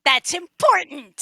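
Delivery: loud, high-pitched outburst; emphatic shout. Real, not acted. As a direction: shout it as an urgent point. Player protests landing safely matters.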